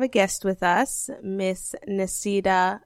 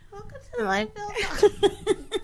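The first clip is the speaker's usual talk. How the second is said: high put-on intro voice; bright podcast host intro; phone voice; 12 semitones higher than usual. Shown as a demonstration. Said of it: "my voice sounds so strange".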